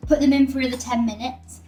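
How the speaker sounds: normal talking voice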